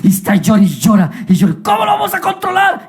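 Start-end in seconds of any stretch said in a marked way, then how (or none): 1.6-2.9 high-pitched cry of desperation; acted panic, pitched way up; panicked parent. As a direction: wail the question at your wits' end. Parents panic at crying baby.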